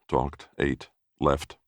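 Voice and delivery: ordinary delivery, nothing marked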